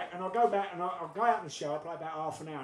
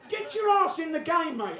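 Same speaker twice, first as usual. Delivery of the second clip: higher, mock-scolding call; taunting the striker; 9 semitones higher, 4 dB louder than usual. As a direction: goad the goal hanger. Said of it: "I said".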